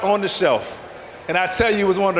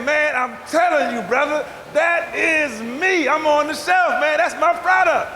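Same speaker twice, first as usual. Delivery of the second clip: insistent; 6 semitones higher than usual. Retold or imitated. Insisting to the janitor.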